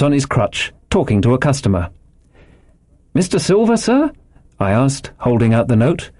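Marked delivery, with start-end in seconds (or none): 3.1-4.1 put-on high-pitched, questioning boy; high voice, lifting into a question; boy's polite question. A narrator's reading. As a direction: politely ask in a raised young voice. Boy timidly addresses the landlord.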